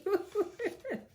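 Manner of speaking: high, bright burst of laughter. Real, not acted. Laughing at brother's note.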